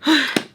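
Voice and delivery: loud sharp gasp; quick audible intake of breath. Retold or imitated. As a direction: gasp as if caught out. Reacts to friend's question.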